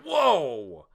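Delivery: a loud, high mock-startled cry in a put-on voice; Usopp whoa. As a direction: jolt back like you just saw a monster. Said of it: "Usopp's like".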